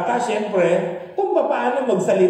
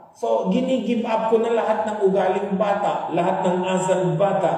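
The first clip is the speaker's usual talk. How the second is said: usual speaking voice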